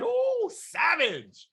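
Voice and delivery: slow, high drawn-out oh savage; stunned reaction. Retold or imitated. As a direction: let it hang in mock shock. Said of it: "I said".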